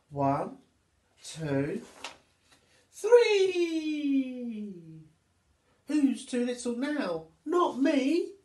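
counting up to a high, loud cry of triumph; little monkey proudly yelling she's not too little; triumphant monkey. Acted. Monkey counts then cheers triumphantly.

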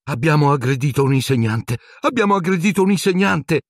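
high, rising frightened whine; whimpering panic. Acted. Girl horrified at attacking a teacher, whimpering. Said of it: "piagnucolò Hermione".

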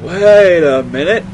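mock realisation.

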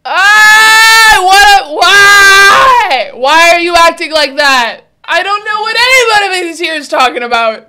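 high, very loud shouted outburst; exasperated yelling. Real, not acted. Reactor yelling at the people in the video, exasperated. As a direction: scream it in baffled exasperation.

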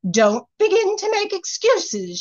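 put-on high, strange scolding old fairy; wicked fairy scolding. A narrator's reading. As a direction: wag a finger as you scold. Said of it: "she said, shaking her finger".

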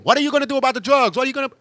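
high, fast mock demand; mock complaining public. Complaining public badgering the government, high fast mock demand. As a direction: squeak it out like a nagging crowd. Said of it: "people started complaining about it".

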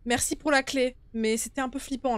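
ordinary talk

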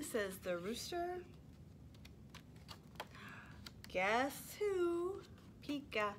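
lively, sing-song storytime reading; animated picture-book read-aloud; reading to toddlers. Acted. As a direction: read it like you're holding the book up for little ones.

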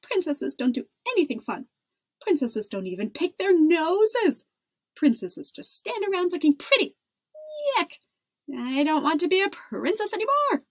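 high-pitched indignant tirade; crying out. A narrator's reading. Princess listing what princesses can't do, crying out. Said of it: "cried Isabella".

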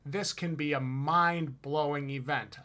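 ordinary talk, nothing marked

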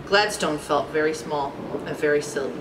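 low, soft storybook reading; subdued narration. A narrator's reading. Narrator tells of Gladstone's humbling, subdued. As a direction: read it small and understated.

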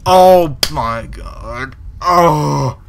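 slow, loud oh my god; relieved exultant groan; drawn-out relief. Real, not acted. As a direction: exhale the victory.

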